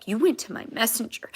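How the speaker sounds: ordinary delivery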